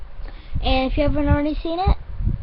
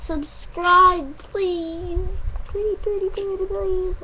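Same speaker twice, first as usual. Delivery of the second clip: pleading; 7 semitones higher, slower than usual. Real, not acted. Begging viewers to subscribe.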